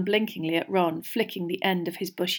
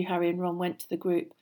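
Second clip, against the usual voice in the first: ordinary delivery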